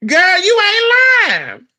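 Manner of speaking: high, loud, drawn-out exclamation; excited agreement. Real, not acted. Agreeing loudly with the commenter, high excited cry. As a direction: belt it out like an emphatic amen.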